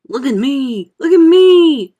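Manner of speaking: high, loud, thrilled shouts; excited shout. Acted. Centipede showing off to friends, excited shout. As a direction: shout with glee, building. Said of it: "shouted the centipede excitedly".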